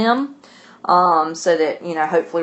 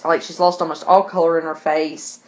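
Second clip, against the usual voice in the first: normal talking voice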